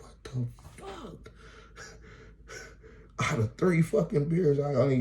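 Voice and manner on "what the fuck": high, drawn-out, softened disbelief; stunned disbelief. Retold or imitated. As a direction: stretch it out, high and small, in shock. Stunned as driver leaves with beers.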